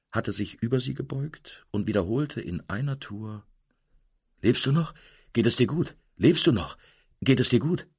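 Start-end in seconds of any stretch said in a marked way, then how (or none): 4.4-7.9 lauter, höher, drängend besorgt; besorgtes Nachfragen. A narrator's reading. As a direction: immer wieder aufgeregt nachfragen. Fremder fragt besorgt wiederholt. (aufgeregt besorgt)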